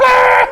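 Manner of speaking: high, loud battle scream; hammed-up charging yell. X-Men blasting everything at the enemy, loud yell. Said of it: "all the X-Men are like".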